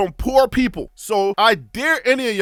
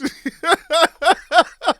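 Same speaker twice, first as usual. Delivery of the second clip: high laugh; 5 semitones higher than usual. Real, not acted. Laughing about being canceled.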